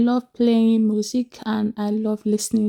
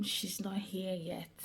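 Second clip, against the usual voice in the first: soft secretive whisper; hushed aside on arriving home; 2 semitones lower, 14 dB quieter than usual. Real, not acted. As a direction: tell the camera quietly so the house doesn't hear. Sneaking home, guest not arrived.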